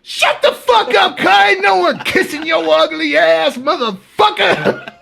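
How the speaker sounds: high, loud imitated heckling; mock angry shouting. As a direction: shout the ranter down at the top of your voice. Inmates shout him down.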